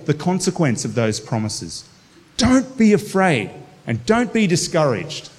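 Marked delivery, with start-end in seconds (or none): 2.4-5.3 higher, fervent declaration; fervent proclamation. Acted. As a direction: proclaim it with conviction. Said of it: "proclaiming the verse".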